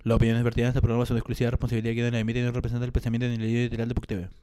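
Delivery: rapid low legal fine print; fast disclaimer read. Acted. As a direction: rattle off the small print. Reading the show's disclaimer.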